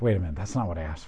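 fast, low, under-the-breath protest; quick muttered aside; muttered objection. Retold or imitated. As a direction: grumble it quickly to yourself. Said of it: "Moses is like".

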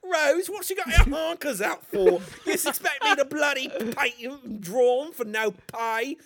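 indignant British movie parody. Acted. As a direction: grumble in a put-on British accent. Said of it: "Can we do my British voice again?".

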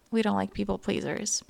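ordinary talk, nothing marked

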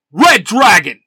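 very loud, high battle cry; full-force hero shout; yelled morph call. A narrator's reading. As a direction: bellow it as the team leader. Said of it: "Jason yells".

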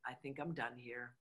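quiet, low, resigned. Retold or imitated. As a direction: let it drop as if walking away. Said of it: "I'm like, You know what?".

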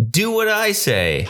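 high-pitched, drawn-out order; hammed-up influencer laying down the law; mock commanding. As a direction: boss the listeners around, slowly. Acting out a bossy influencer.

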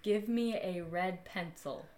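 slow, exaggerated mouthing; over enunciating. Shown as a demonstration. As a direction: stretch each word out slowly for lip reading. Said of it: "Start over".

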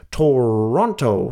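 slow American "Toronto", stretched first vowel; American announcer accent. Retold or imitated. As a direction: say "Toronto" like a US weatherman. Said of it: "He says it like that".

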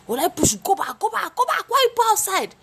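high, loud scolding shout; teacher ordering the girl back to class, shouting; angry teacher yell. Retold or imitated. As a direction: bark it like a furious teacher.

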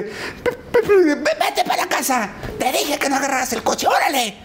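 voz aguda, regaño exagerado entre risas; regaño fingido. Retold or imitated. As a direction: sigue actuando al marido enojado. Continúa el regaño actuado. (papá sigue regañando a la mamá, voz aguda fingida)

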